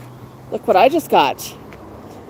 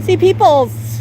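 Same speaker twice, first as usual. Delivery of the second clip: voicing the bird; 8 semitones higher, 4 dB louder, slower than usual.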